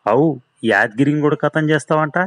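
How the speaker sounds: high, indignant challenge; angry confrontation. Acted. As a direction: confront him, voice pushed up high. Henchman confronts the scientist, glares.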